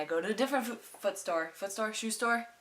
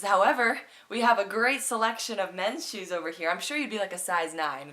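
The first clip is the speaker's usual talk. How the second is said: much louder and higher, upbeat sales pitch; pushy salesman; 3 semitones higher, 9 dB louder than usual. Retold or imitated. Retold salesman steering her to men's shoes, loud and upbeat. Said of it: "He says".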